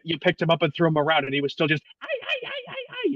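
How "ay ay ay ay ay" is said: tiny squeaky dog yelps, very high and soft; yelping chihuahua. Retold or imitated. Host imitates a chihuahua being thrown around, high yelps. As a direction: squeal like a little teacup chihuahua being tossed.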